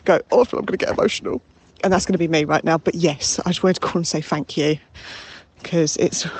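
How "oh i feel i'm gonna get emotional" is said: quick, higher mock-tearful gush; hurried, pitched-up imitation of getting emotional; imitating emotional callers. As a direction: lightly send up a caller about to well up. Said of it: "They call up all calm and collected and then".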